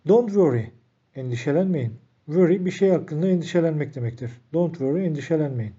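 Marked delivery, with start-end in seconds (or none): 0.1-0.7 model phrase reading. Shown as a demonstration. Teacher presenting the phrase to learners, raised and clear. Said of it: "teacher models the English phrase".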